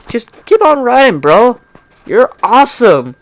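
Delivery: high, bright cheer to a fan; excited shout-out. Real, not acted. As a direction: hype up the writer. Shout-out to fanfic writer.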